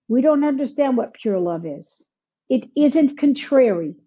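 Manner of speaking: ordinary talk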